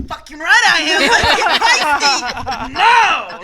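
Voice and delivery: loud, drawn-out gleeful yell, pretending to flirt back; gleeful shout. Woman answers an imagined Australian flirt with a thrilled, loud shout. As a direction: belt it out like you're thrilled to be called feisty. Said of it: "I'd be like".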